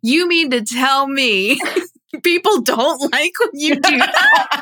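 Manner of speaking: laughing in disbelief. Real, not acted. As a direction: asks it through real laughter.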